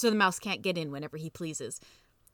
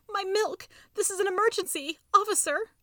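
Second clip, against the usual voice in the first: very high, frantic mouse plea; high-pitched desperate appeal; pleading; 13 semitones higher, 3 dB louder than usual. A narrator's reading. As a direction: beg urgently in a squeaky voice. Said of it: "pleading for them to get his milk".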